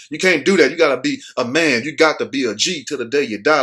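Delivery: ordinary talk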